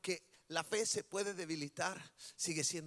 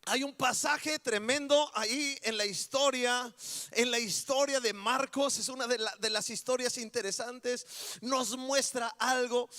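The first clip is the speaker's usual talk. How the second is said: higher, louder sermon voice telling the story; full-force preaching; 5 semitones higher, 5 dB louder than usual. Acted. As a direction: preach it out with fire.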